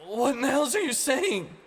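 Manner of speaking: high, loud baffled demand; disbelieving outburst; bewildered shout; puzzled. Acted. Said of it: "the mechanist puzzled".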